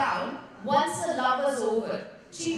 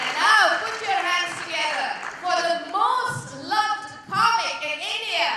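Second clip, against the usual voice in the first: stage announcer introduction; 4 semitones higher, 7 dB louder than usual. Real, not acted. Comic to audience introducing the headliner, loud announcer hype.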